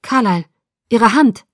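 loud, urgent call; alarmed. A narrator's reading. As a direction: call his attention in alarm. Alarm on seeing the bitten hand. (alarmiert und laut gerufen)

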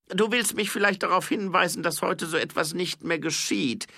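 high, loud, measured king's voice; herrischer Zwergenkönig. A narrator's reading. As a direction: speak down to an envoy, high and forceful. (hoher, lauter Zwergenkönig, bedächtig)

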